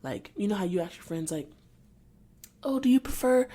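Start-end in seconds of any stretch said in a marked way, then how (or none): 2.6-3.5 higher, louder imitation of a questioner; mock fussy question. Mimicking how people question their friends, mock fussy question. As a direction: mimic an over-asking friend.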